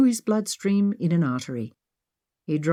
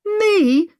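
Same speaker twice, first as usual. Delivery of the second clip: indignant; 6 dB louder than usual. Acted. Indignant retort in quarrel.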